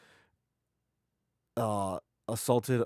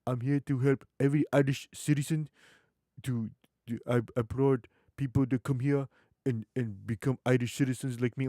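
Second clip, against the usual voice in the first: put-on African accent, quoting a woman; heavy African accent; 3 semitones higher than usual. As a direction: imitate her thick African accent. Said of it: "with that heavy African accent".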